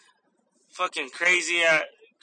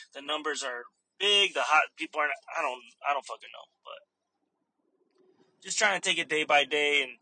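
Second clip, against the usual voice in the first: ordinary talk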